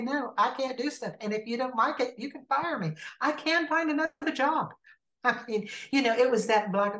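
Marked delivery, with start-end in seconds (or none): 0.0-4.7 high-pitched, emphatic standing up for herself; animated, defiant pep talk to oneself; retold self-assertion. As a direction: imitate someone finally saying no. Retold newfound assertiveness to employer.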